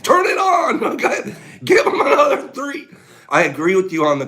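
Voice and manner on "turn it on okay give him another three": high, loud, through laughter; laughing. Real, not acted. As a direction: joke while laughing. Laughing joke about dosing.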